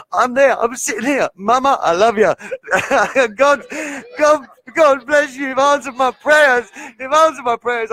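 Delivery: host overcome meeting his hero, voice high and emotional; overwhelmed with joy. Real, not acted. Host thanking his mother and God, overjoyed and high-pitched. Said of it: "I'm gonna try not to cry and get emotional".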